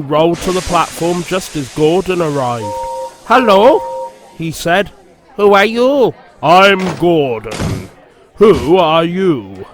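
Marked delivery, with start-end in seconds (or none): 3.3-6.1 cheeky engine. A narrator's reading. Sir Handel greets Gordon. 6.4-9.7 slow, pompous big engine; slow and pompous, like a grand express. A narrator's reading. Gordon, big engine, replies.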